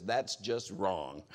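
low, slow, laughing verdict; son's low, slow deadpan judgment. Retold or imitated. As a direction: drop it flat and slow as the unimpressed teen. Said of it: "He goes".

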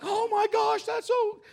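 very high, loud, breathless awe; gushing amazement. Retold or imitated. Onlookers reacting to the miracle, very high excited squeal. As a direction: squeal it in wonder. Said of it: "they're like".

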